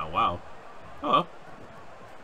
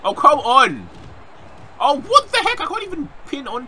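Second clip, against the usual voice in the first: high, loud protest; exasperated outburst; 8 dB louder than usual. Real, not acted. Gamer protesting a failed pin, high exasperated yell. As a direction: yell at the game in disbelief.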